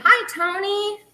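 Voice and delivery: high, loud cheerful hello; excited greeting. Real, not acted. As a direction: greet him brightly and loudly. Greeting same viewer again, brightly.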